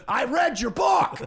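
high, very loud drawn-out bellow; over-the-top commanding fan yell; George C. Scott as Patton. Retold or imitated. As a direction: shout it slowly, stretching the words. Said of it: "I'm like George C. Scott and Patton".

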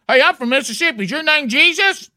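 high-pitched mock Mississippi yokel; Speedy. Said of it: "Speedy would be like".

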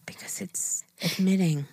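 soft whisper, admitting something. Real, not acted. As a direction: keep it hushed and sheepish. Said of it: "Why are you whispering now?".

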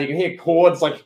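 normal talking voice

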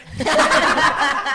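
a burst of real loud laughter; cracking up at the waitress's answer. Real, not acted.

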